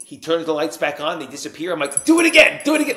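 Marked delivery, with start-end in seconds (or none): 2.1-3.0 put-on high, loud, like a kid at a magic show; mock-thrilled shout for an encore; amazed, excited begging. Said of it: "like it's a magic trick".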